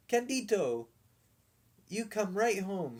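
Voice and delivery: put-on small boy crying out to the dog. A narrator's reading. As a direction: call the dog home urgently. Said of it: "he cried".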